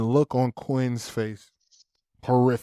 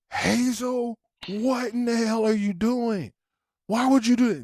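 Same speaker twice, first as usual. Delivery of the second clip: high, yelling dad, much higher; blowing up; 11 semitones higher than usual. Retold or imitated. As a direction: let it blow up at the daughter. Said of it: "I blow up".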